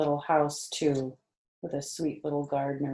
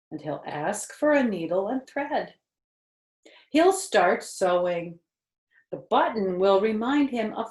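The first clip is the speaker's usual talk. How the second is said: higher, louder storybook voice; reading to children; 6 semitones higher, 6 dB louder than usual. Acted. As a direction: read it brightly to a little listener. Reading picture book aloud.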